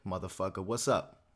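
ordinary delivery

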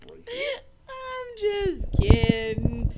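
high, loud, very stretched-out words; laughing backtrack. Real, not acted. Co-host insisting the insult was a joke, laughing backtrack. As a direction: drag it out through helpless laughter.

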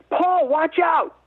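high, slow frantic yell of warning; retold scream. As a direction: shout a panicked warning to a teammate.

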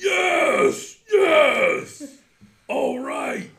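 loud, high cheering yell; triumphant shout. Acted. Dwarf cheers loudly at the winning roll. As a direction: shout it like you just won. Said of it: "Eildon's like".

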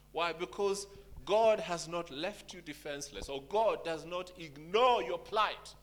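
preaching with force, slowing down. Acted. Pastor preaching to the church, forceful. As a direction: preach it to the congregation, rising.

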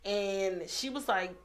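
ordinary talk

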